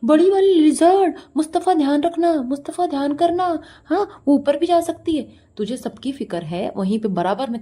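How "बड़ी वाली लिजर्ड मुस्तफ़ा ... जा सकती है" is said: high, loud hammed-up warning; exaggerated yelling of caution; mock shouting. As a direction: imitate an overacting mother calling out. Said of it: "ऐसे कहीं भी चिल्लाने लगती है".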